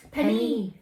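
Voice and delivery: "Penny" in a French accent. Shown as a demonstration. Saying the name Penny as preferred, French accent. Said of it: "like it the French way".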